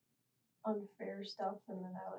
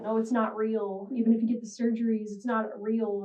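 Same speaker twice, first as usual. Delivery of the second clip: louder, emphatic imitation of dismissal; retold dismissal; 9 dB louder than usual. Teen quoting mom on transitioning, loud retold dismissal. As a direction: insist on it loudly, as retold scolding. Retelling mom's dismissive words.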